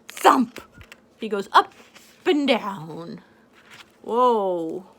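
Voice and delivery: usual speaking voice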